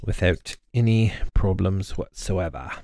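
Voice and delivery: mock-British, as a casual running joke at the workbench; playful British accent. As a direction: slip into a put-on British accent. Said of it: "we love breaking into British accents".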